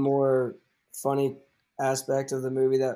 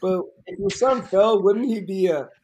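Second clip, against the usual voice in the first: high, overly polite neighbour voice, pitched up; chirpy Ned Flanders put-on, raised and a bit louder; Flanders impression; 6 semitones higher, 5 dB louder than usual. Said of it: "some classic Flanders, like, thing going".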